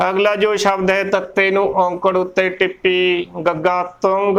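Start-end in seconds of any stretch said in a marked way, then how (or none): 4.0-4.4 ਤੁੰਗ with rounded vowel and nasal, "tung"; both signs sounded. Shown as a demonstration. Teacher sounding both vowel signs, careful pronunciation. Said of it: "ਦੋਨੇ ਮਾਤਰਾਵਾਂ ਦਾ ਉਚਾਰਨ".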